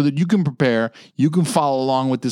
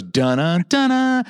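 hummed theme; 5 semitones higher, slower than usual. Retold or imitated. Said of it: "the music goes".

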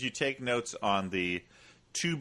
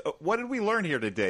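lightly acted teacher, higher pitch; teacher quizzing kids; 6 semitones higher, faster than usual. Teacher asking the kids about today's lesson, mock-teacherly. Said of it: "he's asking the kids".

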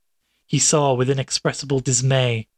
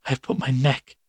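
hoarse. A narrator's reading. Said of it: "still somewhat hoarsely".

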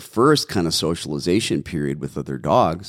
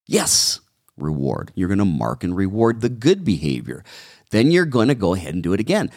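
ordinary delivery

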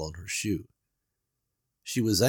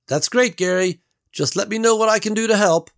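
higher, louder, said for him to hear; 12 semitones higher, 7 dB louder than usual. A narrator's reading. Beverly answers Gary out loud after a private jab. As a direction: speak up so he hears. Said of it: "Out loud".

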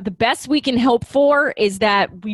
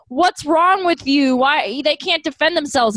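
mock Goku scolding; 6 semitones higher than usual. Goku voiced scolding Vegeta.

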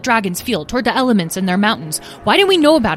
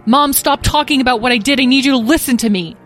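higher, louder, insistent outburst; angry interruption; 8 semitones higher, 8 dB louder than usual. Acted. Azriel interrupting Mom's lecture, angry demand. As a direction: cut in and demand to be heard.

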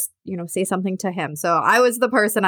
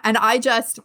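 ordinary delivery

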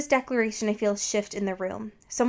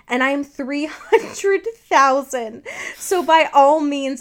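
slow, louder and higher menacing boast; growl; 5 semitones higher, 7 dB louder, slower than usual. A narrator's reading. Bella boasting her age to Edward, a slow rising growl. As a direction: lean in and menace him slowly, rising in volume. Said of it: "I growl at him".

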